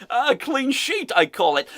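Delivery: put-on high, drawn-out Dutch accent; mock Dutch accent. Host echoing manager's football term, mock Dutch accent. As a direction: relish the phrase in fake Dutch-English. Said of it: "I love that".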